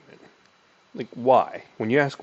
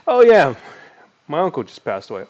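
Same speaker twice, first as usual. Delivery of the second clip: high, loud mock prospect; lightly voiced sad prospect; 6 semitones higher, 13 dB louder than usual. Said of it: "they might say something like".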